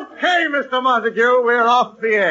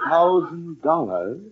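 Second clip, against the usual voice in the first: normal talking voice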